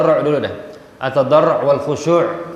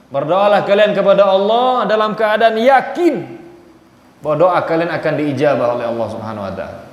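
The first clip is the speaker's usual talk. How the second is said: ordinary talk, nothing marked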